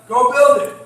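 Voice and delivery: loud, high-pitched command; urgent exhortation. Real, not acted. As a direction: shout it as a challenge to act. Exhorting the group to act.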